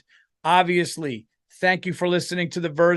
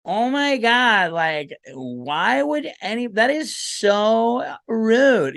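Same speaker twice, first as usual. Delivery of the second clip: higher voice, very stretched-out vowels; mock offended woman; 6 semitones higher, much slower than usual. Imagined woman senator whining at a big speech, slow mock indignation. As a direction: play an appalled senator, dragging out every word. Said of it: "She's like".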